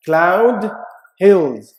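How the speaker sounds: hills hit hard and loud; rhyme words stressed. Shown as a demonstration. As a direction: punch the rhyme word.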